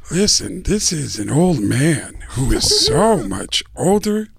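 slow, drawn-out movie-star impression; put-on slow, dramatic actor voice; Al Pacino. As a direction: stretch the words with theatrical weight. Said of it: "Do Al Pacino".

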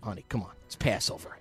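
whispered. Retold or imitated. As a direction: whisper to your wife to persuade her. Coaxing wife after the dinner.

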